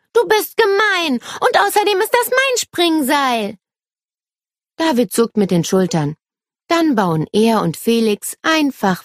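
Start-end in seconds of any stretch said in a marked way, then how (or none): 0.1-3.6 put-on high-pitched little-girl protest; indignant calling out. A narrator's reading. Nele accusing David over her skipping rope, indignant. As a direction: accuse him in a high, cross voice. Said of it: "ruft sie".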